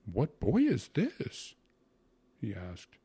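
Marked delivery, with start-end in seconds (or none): 0.1-1.5 slow, loud, high-pitched question; utterly astonished. A narrator's reading. Uncle suddenly notices his nephew from the carriage, astonished. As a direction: draw it out in amazement, pitch leaping. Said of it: "A look of the utmost astonishment".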